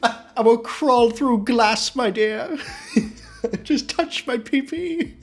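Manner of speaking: mock British plea. Man begging a woman for a touch, hammed-up British plea. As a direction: grovel like a desperate British suitor. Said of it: "like, British".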